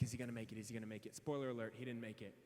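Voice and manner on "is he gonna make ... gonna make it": much lower and softer nervous repeat; anxious whisper. Retold or imitated. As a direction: fret under your breath. Anxious waiting for new player.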